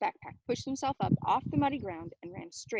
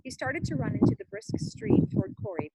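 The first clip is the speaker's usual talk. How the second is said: plain reading voice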